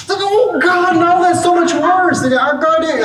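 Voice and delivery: put-on high, loud child squeal; shrieking little kid, high and loud; toddler wail. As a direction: cry out like a three-year-old in disgust. Toddler after blowing nose, voiced.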